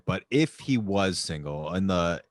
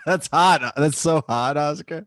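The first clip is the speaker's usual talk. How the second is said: mock flirty; 5 semitones higher, 5 dB louder, slower than usual. Teasing Oscar about back pain.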